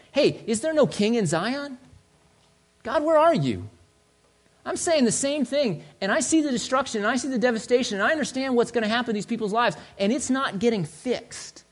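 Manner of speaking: exasperated high-pitched plea; frustrated. Acted. Prophet challenges God over the destruction, frustrated. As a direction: demand answers, acting out frustration. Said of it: "He's frustrated".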